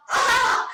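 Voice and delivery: high, loud "ah!"; sudden high-pitched playful shout; mock game yell. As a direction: squeal out an "ah!". Excited cry in mock game.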